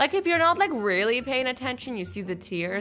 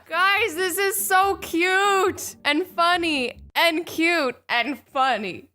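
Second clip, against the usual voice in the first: gushing; 6 semitones higher, 3 dB louder, much slower than usual. Real, not acted. Gushing over grandma memes.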